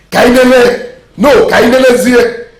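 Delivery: calling out. Acted. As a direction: call the servant across the palace. King calling out.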